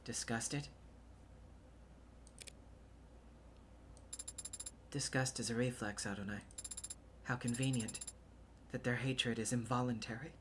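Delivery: low, soft and withdrawn; subdued, wounded reply. Acted. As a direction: answer quietly, keeping it low. Android character answers contempt, hurt.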